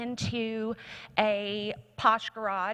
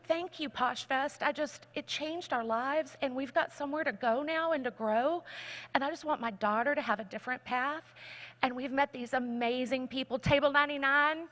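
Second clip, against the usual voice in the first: ordinary delivery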